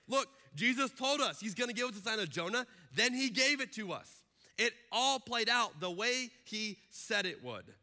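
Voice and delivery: impassioned sermon peak; preaching at full force. Acted. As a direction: drive it home to the congregation like a pulpit climax. Preaching climax, raised voice.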